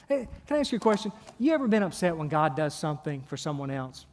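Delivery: usual speaking voice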